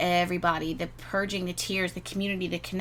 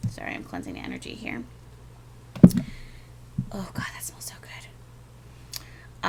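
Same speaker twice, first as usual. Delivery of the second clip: whispered aside; 11 semitones lower than usual. Real, not acted. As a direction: breathe it to yourself while smudging. Aside while cleansing the energy.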